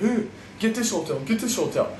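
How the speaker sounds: high-pitched imitation of a pushy hotel tout. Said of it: "being all pushy and shit".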